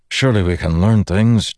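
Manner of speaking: plain reading voice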